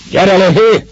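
high, loud shout to someone above; calling up. A narrator's reading. Master calling up to Viktor in the hayloft, shouting. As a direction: holler up for him to come down. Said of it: "kiáltott föl Viktornak az úr".

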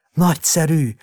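put-on high, loud child's exclamation; delighted cry. A narrator's reading. Sick boy sees the balloon burst, crying out delightedly. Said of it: "kiáltotta beteg, és kacagott".

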